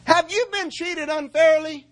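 high, loud, slow call to the church; shouting question. Real, not acted. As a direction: cry it out to the people. Calls out to congregation.

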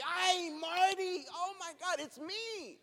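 high, drawn-out mock exclamation; teasing angel. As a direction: call out high like an amused angel poking fun. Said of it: "he's just laughing at me".

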